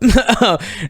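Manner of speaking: high burst of laughter. Real, not acted. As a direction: laugh at your own slip. Laughing at own slip.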